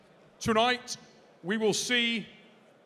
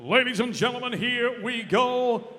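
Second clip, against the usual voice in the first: booming pumped announcer; 3 semitones higher, 4 dB louder than usual. Acted. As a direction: stretch it out for the crowd. Opens headliner introduction.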